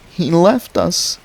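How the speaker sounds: high, slow steady wailing; monotonous wail. Acted. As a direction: wail it over and over, unchanging. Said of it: "in a steady, monotonous wail".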